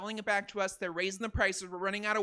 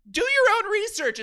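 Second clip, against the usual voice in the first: high, loud, defensive snap; rattled, raised-pitch retort; flustered outburst; 10 semitones higher, 7 dB louder than usual. Acted. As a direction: blurt it out like a flustered internet commenter. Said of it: "you get flustered".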